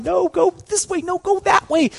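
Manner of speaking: high, half-hearted crowd yell; a light retold crowd call from the aisles; mock crowd yelling. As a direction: imitate people shouting directions. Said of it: "people are yelling".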